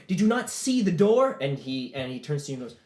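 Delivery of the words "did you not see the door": high, loud, incredulous question; indignant retort. Acted. Arguing over the broken door, indignant retort. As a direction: demand it with acted outrage.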